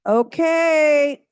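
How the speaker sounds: loud call over a chatting room; outside voice. Real, not acted. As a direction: raise your voice to get the room's attention. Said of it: "don't make me use my outside voice".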